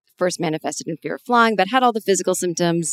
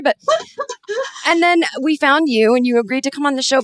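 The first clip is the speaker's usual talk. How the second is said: normal talking voice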